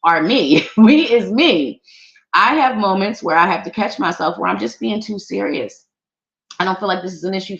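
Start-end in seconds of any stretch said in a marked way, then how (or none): 0.8-1.8 laughing joke. Real, not acted. Joking grammar correction.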